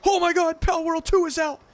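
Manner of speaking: high squealing excitement; excited fan. Retold or imitated. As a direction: gush like a hyped fan. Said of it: "And everyone's like".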